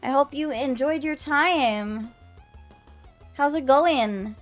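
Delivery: high bright streamer voice; bubbly anime girl greeting; cute anime streamer. Acted.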